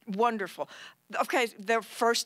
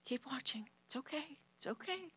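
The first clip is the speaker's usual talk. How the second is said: much softer, rising comfort; hushed reassurance; 4 semitones higher, 13 dB quieter than usual. Retold or imitated. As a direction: soothe him in a near whisper. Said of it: "I keep going".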